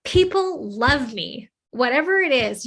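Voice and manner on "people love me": slow, higher, emphatic affirmation; drawn-out, emotional self-affirmation; with emotion and conviction. Shown as a demonstration. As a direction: declare each word slowly and strongly, with heartfelt conviction. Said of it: "you're gonna say it with emotion".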